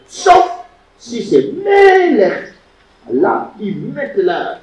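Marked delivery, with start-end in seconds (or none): 1.5-2.5 melech with ME high, loud and drawn out; stress on first syllable. Shown as a demonstration. Teacher showing the exception, first syllable heavily stressed. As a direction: stretch and punch the ME of melech. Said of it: "l'accent visible pour que tout le monde".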